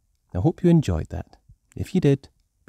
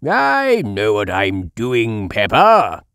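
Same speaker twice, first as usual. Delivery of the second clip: slow, louder confident grandpa; Grandpa Pig voice; 6 dB louder than usual. A narrator's reading. Grandpa Pig assuring Peppa he knows what he is doing. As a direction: brush off the worry. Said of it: "replies Grandpa Pig".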